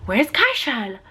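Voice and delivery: singsong question with swooping pitch; imitated child's lilting query; imitated toddler asking. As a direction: ask like a two-year-old missing siblings. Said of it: "so he'd be like".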